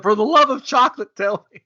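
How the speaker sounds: put-on high, loud German-accented plea. Visitor pleading with the chocolatier, high mock German accent. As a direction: beg at full pitch in a mock German accent. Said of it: "he says this in a German accent".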